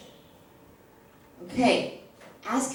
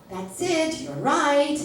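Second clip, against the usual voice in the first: high, loud, excited praise; 6 semitones higher, 3 dB louder, slower than usual. Shown as a demonstration. Teacher cheering a student's right answer, excited. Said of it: "praising a correct answer".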